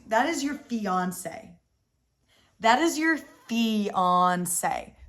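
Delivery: slow with IS stressed. Real, not acted. Correcting Sam about his fiance, slow with "is" stressed. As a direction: drag out "That is your fiance", leaning on "is". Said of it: "emphatic correction".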